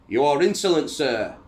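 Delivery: loud, high-pitched imperious rebuke; indignant outburst. A narrator's reading. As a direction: explode at an insolent subordinate. Napoleon rages at defiant admiral.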